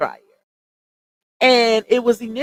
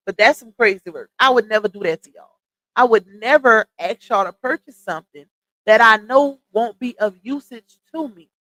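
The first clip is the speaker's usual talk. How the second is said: ordinary talk, nothing marked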